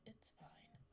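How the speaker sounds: whisper. Real, not acted. Muttering reassurance to self.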